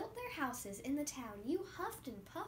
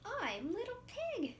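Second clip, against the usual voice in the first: higher, small piggy voice; Little Pig; 9 semitones higher than usual. Acted. Child playing the little pig, higher voice. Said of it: "I'm going to be the little pig".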